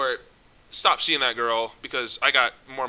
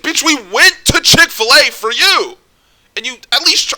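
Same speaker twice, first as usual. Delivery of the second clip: higher, louder exasperated yell at her; angry yell; 10 semitones higher, 11 dB louder than usual. Retold or imitated. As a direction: shout it, fed up and indignant. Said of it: "I was mad at this girl".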